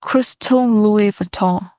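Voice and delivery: slow, drawn-out British accent; put-on British pronunciation. Shown as a demonstration. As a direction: imitate a British speaker, stretching the name. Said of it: "this is British".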